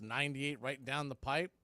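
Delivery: ordinary talk, nothing marked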